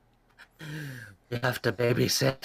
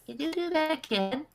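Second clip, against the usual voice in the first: a little dragon begging for more; tiny dragon child. Acted. The dragon child asks Miki for more, high childlike plea. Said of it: "tiny dragon child voice".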